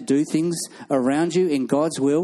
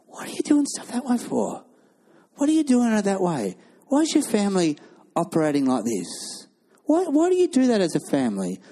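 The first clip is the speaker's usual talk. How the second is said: imitating a questioning newcomer; 5 semitones higher than usual. Newcomer questioning your family.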